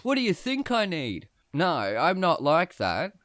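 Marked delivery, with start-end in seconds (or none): 0.0-1.3 loud, higher macho boast; cocky bragging. Pretend. Pretending to be the cocky guy at the counter, bragging loudly. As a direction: brag like a swaggering show-off. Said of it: "seem like a wanker".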